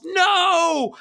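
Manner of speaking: high, loud mock yell. Zot yelling at the brick wall, mock yell. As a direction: shout it in disbelief. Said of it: "Zot yells".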